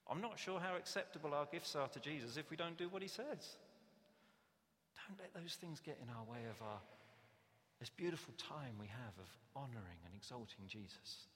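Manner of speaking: usual speaking voice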